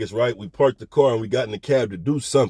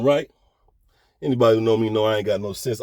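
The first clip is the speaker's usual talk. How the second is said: ordinary delivery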